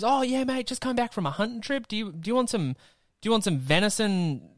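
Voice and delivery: high-pitched mocking imitation of an actor; mock celebrity quote. Actor back from a hunting trip offering venison, mocked in high voice. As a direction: put on a high, over-friendly hunter voice. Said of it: "And he's".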